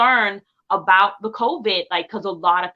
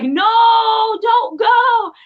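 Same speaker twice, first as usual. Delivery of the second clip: high, louder half-sung plea; pleading in a dream; 13 semitones higher, 7 dB louder, much slower than usual. Retold or imitated. Singer reliving begging her late dad in a dream, pleading high. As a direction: beg him not to leave. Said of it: "And I'm like".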